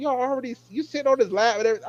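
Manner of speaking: high, fast exasperated rattle; exasperated disbelief. Retold or imitated. Telling the characters off, high fast exasperated. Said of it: "I was like".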